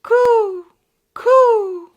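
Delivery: very high, loud cooing; dove cooing. Acted. Dove calling down from a tree, high coo. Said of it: "a dove called".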